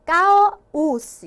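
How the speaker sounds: 까오스 said slowly as 까-오스, the vowels 아 and 오 kept apart; syllables apart. Shown as a demonstration. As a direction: pull 까오스 apart, 까 then 오스, slow and loud. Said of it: "이렇게 따로따로". (까오스를 아와 오를 끊어서 천천히)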